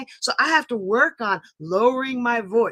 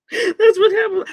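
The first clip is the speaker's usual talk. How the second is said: laughing hard; 8 semitones higher, 8 dB louder than usual. Real, not acted. Laughing at forgetting.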